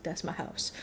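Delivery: a soft, breathless whisper of disbelief; stunned whisper. Retold or imitated. Fireman realizing the call is his own house, whispering. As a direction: barely voice it, stunned.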